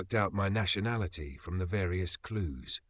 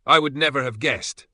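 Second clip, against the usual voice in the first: dry teasing irony, higher and louder; ironic; 5 semitones higher, 9 dB louder than usual. A narrator's reading. Mangiarotti mocking the indignant Englishman, ironic. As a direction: tease him with mock surprise. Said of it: "unmistakable tone of irony in his voice".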